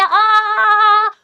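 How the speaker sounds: loud, high drawn-out "aah"; retold scream. Student retelling waking to hair on her face, screaming. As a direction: re-enact screaming in alarm. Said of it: "मैंने चिल्लाया".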